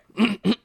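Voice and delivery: short preparatory throat clear. Real, not acted. Host about to summarize the film, clearing throat. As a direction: clear your throat before reading.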